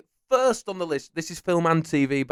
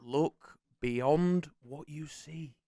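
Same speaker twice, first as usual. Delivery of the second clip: slow, softer, stretched-out delivery; mystical film quote; 7 dB quieter, much slower than usual. Acted. Host repeats the quote for the stuck contestant, slow solemn delivery. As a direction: intone each word slowly.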